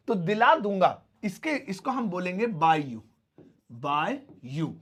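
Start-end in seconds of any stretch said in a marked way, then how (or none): none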